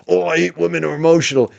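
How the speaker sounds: fast, put-on mocking voice; mocking tough-guy parody; mocking Kane.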